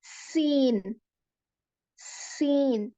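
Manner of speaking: सीन with a sharp whistling s, teeth together; whistling seen. Shown as a demonstration. Teacher demonstrating the letter seen, whistling s. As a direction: hiss the s of सीन between closed teeth. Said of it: "एक सीटी जैसी आवाज निकलती".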